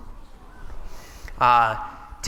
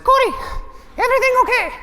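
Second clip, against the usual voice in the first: put-on very high and louder, squeaky Italian-accented call; squeaky Italian Simone; 5 dB louder, faster than usual. Partner calling to the buried narrator, very high, squeaky and Italian. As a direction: squeak it out like a tiny Italian climber. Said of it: "this very high, squeaky Italian voice".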